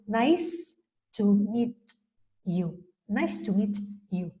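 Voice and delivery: "nice to meet you" slowly, each final consonant finished; slow, crisp endings. Shown as a demonstration. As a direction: give every word of "nice to meet you" its time. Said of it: "Sin embargo, ellos". ("nice to meet you" lento, cerrando cada consonante)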